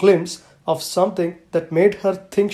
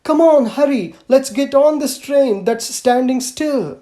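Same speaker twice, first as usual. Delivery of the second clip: higher and louder eager call back; calling over shoulder; 7 semitones higher, 5 dB louder than usual. Acted. Little girl calling to Mother while running, higher and louder. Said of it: "calling out to Mother over her shoulder".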